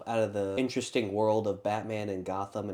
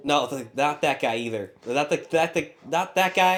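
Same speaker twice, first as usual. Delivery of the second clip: rising flustered correction; 5 semitones higher, 7 dB louder than usual. Real, not acted. Flustered correcting which guy he meant.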